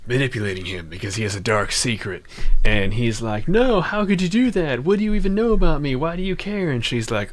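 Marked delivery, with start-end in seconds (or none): 3.5-6.7 indignant protest. Retold or imitated. Protagonist protests to manipulative mentor.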